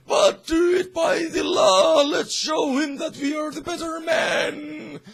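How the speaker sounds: high, loud heroic shout; shouting after. Retold or imitated. Gordon shouts after Batman, high loud call. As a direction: call it after him with fervour. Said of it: "the Gordon shouts after him".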